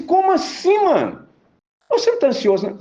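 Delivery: can't believe he's sleeping through this; disbelief. Retold or imitated. Preacher voicing disbelief at sleeping Jesus, high and incredulous. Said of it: "Você assim".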